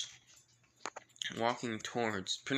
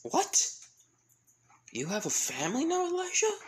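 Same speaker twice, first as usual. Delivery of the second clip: very high, incredulous outburst; hammed-up shock, voice shooting up; 4 dB louder than usual. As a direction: squeal it in comic disbelief. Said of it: "the Wizard King shocked".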